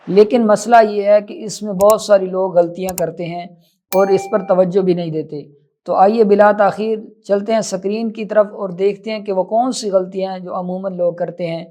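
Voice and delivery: ordinary talk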